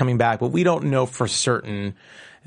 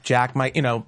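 ordinary talk, nothing marked

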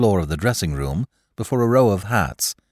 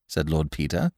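ordinary delivery, nothing marked

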